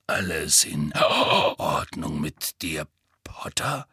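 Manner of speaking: low, slow gruff grumble; grumbling Moody. Acted. Moody checking on Harry, low slow grumble. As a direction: rumble it at him, slow and low. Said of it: "brummte Moody".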